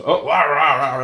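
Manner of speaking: high mock rant in nonsense syllables; imitated yelling. Officer hears about guns in the car, mock yelling. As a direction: burst into a cop's rah-rah outrage. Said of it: "They just like to yell".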